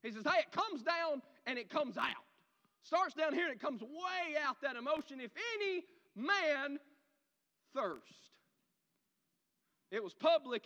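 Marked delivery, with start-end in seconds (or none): 0.2-5.2 loud, high, forceful preaching; emotional outburst. Shown as a demonstration. Preacher demonstrates emotion bursting out. 5.2-8.3 slow, loud cry, high then dropping; emotional shout. Acted. Preacher showing what cried literally means, an emotional shout. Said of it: "a shout that expresses deep emotion".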